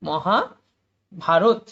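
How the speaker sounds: Mahabharata stretched out very slowly, each syllable held apart; slow syllable split. Shown as a demonstration. Teacher sounding out Mahabharata for students, drawn out. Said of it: "এবং রথটা একসাথে হবে".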